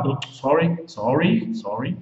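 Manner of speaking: ordinary delivery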